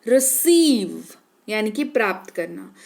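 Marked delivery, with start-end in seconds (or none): 0.1-1.0 "receive" with weight on a long सी; stressed long ई. Shown as a demonstration. Teacher adds force for the long vowel, stressed demo. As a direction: lean hard on the ई in "receive". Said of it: "अब थोड़ा सा जोर डाल करके करेंगे".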